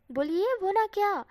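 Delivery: loud, slow, high teasing demand. Acted. Wing teasing shy Wang, loud playful demand. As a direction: push him loudly to finish his sentence.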